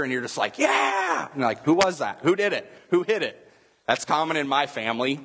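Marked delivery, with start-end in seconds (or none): 0.6-1.3 high, drawn-out mock cheer; imitated cheer. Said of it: "hear the other people in the room cheer". 1.6-3.4 higher-pitched, eager questions; excited fan. Acted. Acted fan after missed home run.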